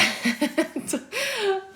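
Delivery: bursts into a high, breathy laugh. Real, not acted. Streamer to chat, laughing off a rude request: high laugh. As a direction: laugh it off, caught out.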